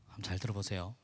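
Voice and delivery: normal talking voice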